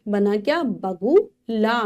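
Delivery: slow, sound by sound blending; teacher spelling for kids. Shown as a demonstration. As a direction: build the word one sound at a time. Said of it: "यहाँ पर हम देख रहे हैं".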